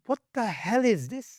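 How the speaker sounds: high-pitched, incredulous reaction; baffled disbelief. Acted. Reader reacting to that statement, baffled disbelief. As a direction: act out being appalled and baffled.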